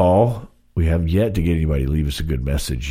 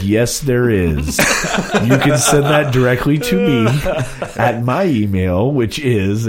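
laughing slow reply; 6 semitones higher, 4 dB louder, slower than usual. Retold or imitated. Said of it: "And I was like".

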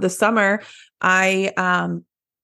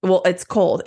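ordinary talk

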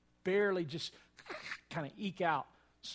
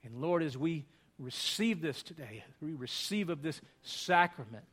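earnest, louder praying voice; communion prayer; 2 semitones higher, 5 dB louder than usual. Acted. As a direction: lift the words up to God in prayer.

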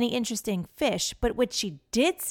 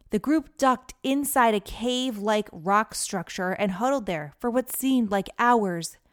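ordinary delivery, nothing marked

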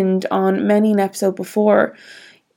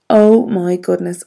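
ordinary delivery

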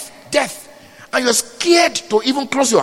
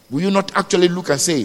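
ordinary talk

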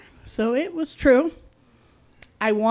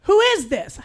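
roommate's voice; 7 semitones higher than usual. Retold or imitated. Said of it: "that same voice answered".

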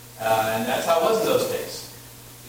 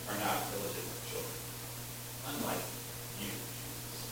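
sneering undertone; 6 semitones lower, 15 dB quieter than usual. Retold or imitated. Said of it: "in the Greek, it's even stronger".

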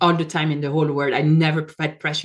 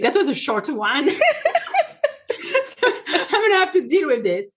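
joking, breaking into high laughter; laughing through it; 10 semitones higher than usual. Real, not acted. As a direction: let the laugh take over the words. Joking her crisis is over.

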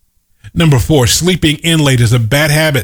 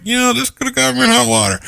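quick, higher, softer replay of the line; light mock quote; 8 semitones higher, 3 dB quieter, faster than usual. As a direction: toss it off lightly, higher and faster. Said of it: "I just said it calmly".

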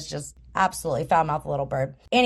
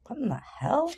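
bewildered man; 7 semitones higher, 7 dB quieter than usual. Acted. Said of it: "looking at this bird like".

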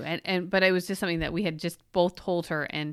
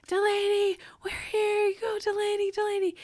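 much higher cheering scream; retold screaming; 14 semitones higher than usual. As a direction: cheer her on from the sidelines. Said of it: "we start screaming".

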